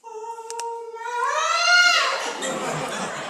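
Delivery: a squealed high "Oh my" with a laugh; mock strained high note. Shown as a demonstration. Director mocking a singer who strains for the high note. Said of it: "So you don't have to go".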